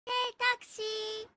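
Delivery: drawn-out call for a cab; hailing a taxi. Pretend. Calling out in a put-on voice.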